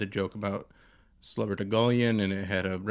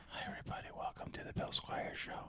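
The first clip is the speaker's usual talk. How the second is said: breathy ASMR whisper, unvoiced; leaning in to whisper; 13 dB quieter than usual. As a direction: hush it like an ASMR host. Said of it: "you want an A S M R podcast".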